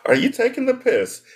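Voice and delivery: put-on British voice, pitched high; mock British accent. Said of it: "That's how they say".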